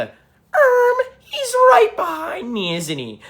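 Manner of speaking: very high, drawn-out mock alarm, dropping at the end; hammed-up movie cliche. Streamer parodying a cliche movie line to viewers, hammed-up. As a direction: ham up a corny movie line. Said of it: "when they do the cliche".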